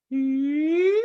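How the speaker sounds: very high, loud sustained ee. Shown as a demonstration. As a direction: hold one long high ee. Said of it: "Try it with me".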